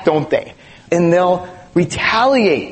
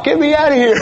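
mock panicked flee; 9 semitones higher, 3 dB louder, much faster than usual. Acting out fleeing.

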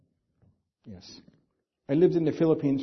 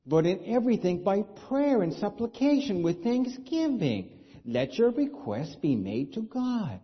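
raised, measured reading of the verse; scripture reading; 7 semitones higher than usual. Acted. Pastor reading scripture to the congregation, raised and measured.

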